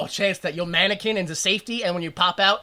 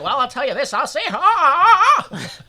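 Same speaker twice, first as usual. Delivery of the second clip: Don Knotts stammer; 7 semitones higher, 5 dB louder than usual. Retold or imitated. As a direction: sputter like a nervous fifties man. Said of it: "Everyone just went".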